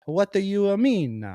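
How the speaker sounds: high, hammed-up Italian old woman; mock Italian accent. Old Italian cook questioning the guest, high mock Italian accent. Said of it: "And she's like".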